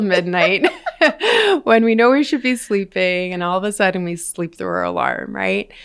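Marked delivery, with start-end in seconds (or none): 0.7-1.6 laugh. Real, not acted. Laughing at her own example.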